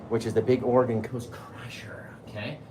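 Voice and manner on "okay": ordinary talk, nothing marked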